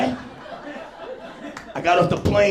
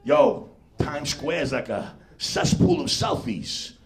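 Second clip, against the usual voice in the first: ordinary delivery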